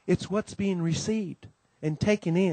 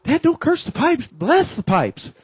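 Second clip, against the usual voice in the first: high, loud scolding wife; mom scolding; 10 semitones higher, 4 dB louder, slower than usual. Retold or imitated. Wife scolding husband under the sink, high and loud. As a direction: scold him to bless the pipes. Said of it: "And Mom says".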